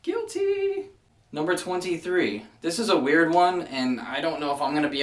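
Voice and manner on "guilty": high squeaky guilty; playful confession. Real, not acted. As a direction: squeal it out, caught red-handed. Admits own mistake playfully.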